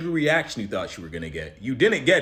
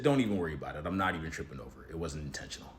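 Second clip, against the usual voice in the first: calm reassurance; 8 semitones lower, 6 dB quieter than usual. Retold or imitated. Calming a stranger who bumped him.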